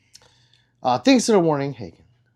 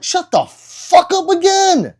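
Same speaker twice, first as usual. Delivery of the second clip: high, loud, drawn-out retort; over-the-top screamed comeback; exasperated shout; 8 dB louder, slower than usual. Retold or imitated. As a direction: yell it slowly in hammed-up outrage. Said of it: "I'm like".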